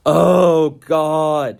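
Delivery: imitating a man's loud, high moan of "Oh, God". Retelling a shelter-mate moaning loudly to himself. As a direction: groan it out loud and high, mocking a sufferer. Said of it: "who was just moaning".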